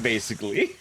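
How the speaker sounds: ordinary talk, nothing marked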